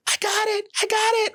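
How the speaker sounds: very high squealing cry of triumph; excited lady. Retold or imitated. Lady in the crowd grabbing the domain, high squeal. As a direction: squeal it like a lady who just won. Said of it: "this lady off in the background going".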